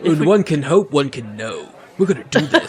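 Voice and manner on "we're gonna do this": high, insistent pep talk; rally a doubting friend forcefully; aggressively encouraging. Acted. Hero rallying a gloomy teammate, aggressively encouraging. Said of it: "He's so aggressively encouraging".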